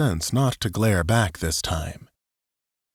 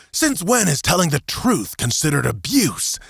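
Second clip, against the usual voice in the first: rising angry outburst, louder at end; 7 semitones higher, 3 dB louder than usual. A narrator's reading. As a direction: build to an angry shout.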